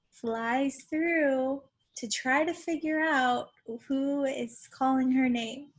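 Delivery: ordinary talk, nothing marked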